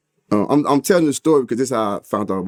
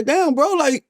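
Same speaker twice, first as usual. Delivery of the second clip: high, stunned question rising to a peak; can't believe your friend is here too; shocked disbelief; much slower than usual. Retold or imitated. Seeing his friend in the cell, stunned and high. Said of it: "I say".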